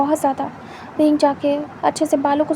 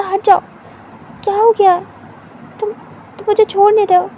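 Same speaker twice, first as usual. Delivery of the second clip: higher, louder flustered protest; upset protest; 5 semitones higher, 4 dB louder than usual. Acted. Wing shoving his lover away, high and loud. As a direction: push him off, voice rising.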